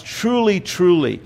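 a solemn Truly, truly, the first one lifted; attention getter. Shown as a demonstration. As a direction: call them to attention with Truly, truly. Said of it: "It is an attention getter".

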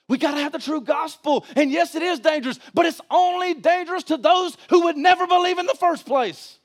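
high, ringing preacher's cry; impassioned, high-pitched sermon delivery; full-force preaching. Real, not acted. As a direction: hammer out rhetorical questions from the pulpit.

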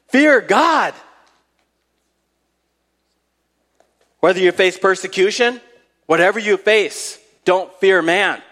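high-pitched, insistent exhortation; emphatic preaching. Real, not acted. Preacher urging listeners to fear God, emphatic preaching. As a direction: drive each phrase home to the congregation.

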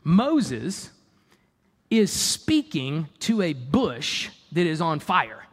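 usual speaking voice

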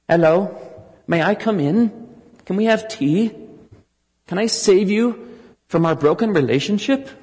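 a poor mock-British vicar politely asking to come in; bad British accent. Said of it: "Sorry for the bad British accent".

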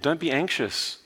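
normal talking voice

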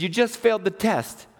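acted-out divine correction; God. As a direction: cut through the protest firmly. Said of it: "He said".

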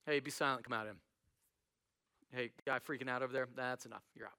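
low, soft, offhand command; near-whisper rebuke. Acted. Jesus casting out the demon, barely raising the voice. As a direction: quietly shut it down, no effort at all. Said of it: "like, a whisper".

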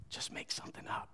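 retold whispered aside; conspiratorial whisper. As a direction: whisper it to the kid beside you. Conspiring quietly with the boy.

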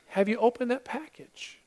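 soft repeat. Real, not acted. Preacher quietly repeating his question to the congregation, soft. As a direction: ask it again gently, almost to yourself.

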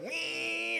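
high, held "wee" of a small motor; thin sustained engine squeal; imitated motor whine. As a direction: mimic a tiny engine at full throttle. Imitates the skiff speeding off.

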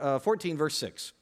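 usual speaking voice

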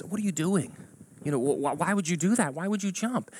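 mildly alarmed plea to a stranger; alarmed questioning. Acted. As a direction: stammer into high, concerned questions. Joke: man stops a jumper.

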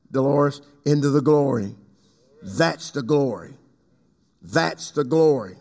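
ordinary delivery

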